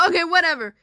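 loud, dismissive brush-off. Acted. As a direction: wave the question away. Principal brushing off the question.

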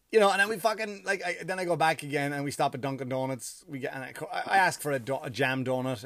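ordinary talk

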